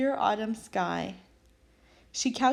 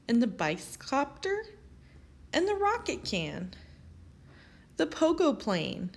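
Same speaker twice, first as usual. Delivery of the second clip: ordinary delivery, nothing marked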